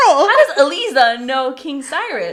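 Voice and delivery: loud, high mock yell; outraged question. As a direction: shout it in hammed-up disbelief. Acting out an outraged onlooker.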